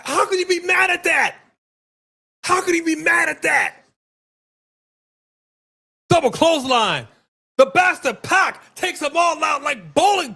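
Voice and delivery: high excited yells; excited shouting. Real, not acted. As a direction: hype up the big spot. Hyped reaction to wrestling move.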